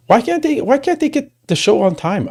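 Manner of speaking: real exasperation at the late start; exasperated. Real, not acted. Complains the show starts late.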